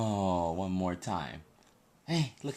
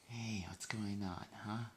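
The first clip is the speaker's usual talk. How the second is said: soft; 4 semitones lower, 12 dB quieter, slower than usual. Real, not acted. Soothing baby at midnight.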